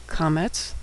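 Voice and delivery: usual speaking voice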